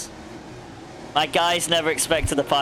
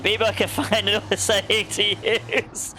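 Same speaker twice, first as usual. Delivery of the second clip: laughing; 7 semitones higher, slower than usual. Real, not acted. Laughing while teasing.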